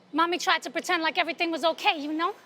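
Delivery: normal talking voice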